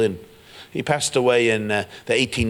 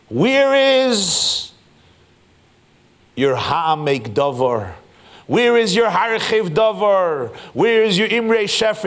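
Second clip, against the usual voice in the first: high, loud, insistent chant of questions; demanding outcry; 9 semitones higher, 5 dB louder, slower than usual. Acted. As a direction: call out each demand, drawn out and high. God acted, demanding the unwritten books.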